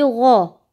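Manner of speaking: गौ said thick and full with rounded lips; heavy gau. Shown as a demonstration. Teacher to Quran pupils, thick rounded गौ. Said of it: "गौ इनको हम मोटा करेंगे".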